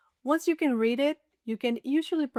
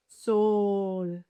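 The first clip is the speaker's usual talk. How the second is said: SOL with a very long, held O; slow, sustained "Sol" for the listener; drawn-out demonstration; 3 semitones lower than usual. Shown as a demonstration. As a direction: stretch the vowel in "Sol". Word stretched for listening practice.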